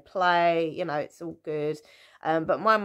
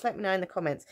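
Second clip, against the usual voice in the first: ordinary talk, nothing marked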